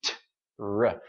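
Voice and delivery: "T, r" with a gap, relaxed T first; separated T and R. Shown as a demonstration. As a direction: release "T" loosely, then a separate "r". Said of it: "your mouth is probably relaxed".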